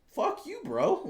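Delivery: high, mocking fuck-off to the troll; livid retort. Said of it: "I was pretty livid".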